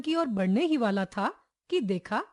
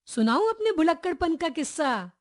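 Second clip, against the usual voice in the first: ordinary delivery, nothing marked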